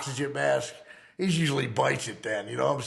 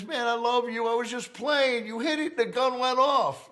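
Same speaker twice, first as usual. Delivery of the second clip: much higher, lightly voiced Kathy; Kathy's excuse; 11 semitones higher than usual. Kathy explaining the gun went off while playing, higher voice. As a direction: play her off as a careless joke, pitched up. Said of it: "She says".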